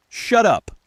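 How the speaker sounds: sudden high, loud outburst; snapping. A narrator's reading. Narrator losing his sanity at both Lindas, snapping. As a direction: snap it angrily, pitch leaping up. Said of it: "I snapped".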